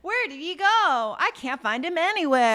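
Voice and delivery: high, hammed-up feigned worry; fake searching. Woman covering for the vanished genie, fake worry. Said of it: "when she does the fake thing".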